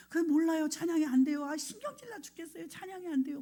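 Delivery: high, fretful mock whine; stressed-out whining. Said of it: "막 스트레스 받더라고요". (짜증 섞인 징징거림 흉내)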